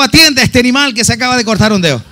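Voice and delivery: laughing joke. Retold or imitated. Wife joking, laughing.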